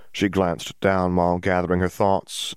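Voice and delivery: ordinary delivery, nothing marked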